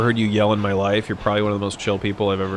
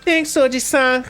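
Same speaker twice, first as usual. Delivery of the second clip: mock polite thanks; 13 semitones higher, 4 dB louder than usual. Thanking shopkeeper in game voice.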